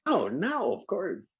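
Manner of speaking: put-on high-pitched comic Czechoslovakian accent. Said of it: "used that accent for that character".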